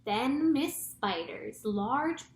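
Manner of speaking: usual speaking voice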